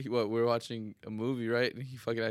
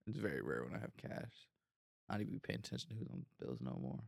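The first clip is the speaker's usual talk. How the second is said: quiet mumble; 6 semitones lower, 16 dB quieter than usual. Real, not acted. Speaker drops voice low.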